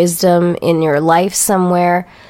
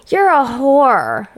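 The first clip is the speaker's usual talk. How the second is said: mock insult; 4 semitones higher, slower than usual. Drawn-out quote of the insult.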